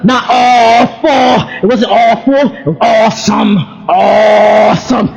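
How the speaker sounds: slow, stretched Not awful and awesome; drawn-out emphasis. Retold or imitated. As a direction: drag out each word to insist. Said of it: "I was like".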